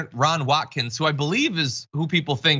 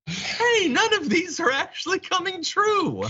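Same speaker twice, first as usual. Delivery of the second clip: much higher, slow, wide-eyed voice; mock dawning realization; slower than usual. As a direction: play a believer slowly waking up, drawn out high. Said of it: "slowly starting to be like".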